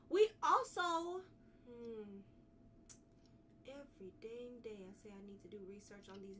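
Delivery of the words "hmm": normal talking voice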